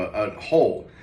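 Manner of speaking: ordinary delivery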